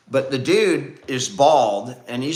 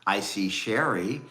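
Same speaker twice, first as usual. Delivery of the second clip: slow, lower sing-song roll call; lightly voiced kids' show hostess; Romper Room host; 6 semitones lower, 4 dB quieter than usual. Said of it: "Miss Barbara from Romper Room".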